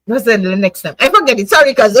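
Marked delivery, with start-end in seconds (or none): none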